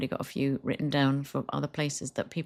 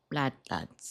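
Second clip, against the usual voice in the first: lad walk voice; 2 semitones lower, 5 dB quieter than usual. Pretend. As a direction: growl it like a builder lad. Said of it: "you made an action".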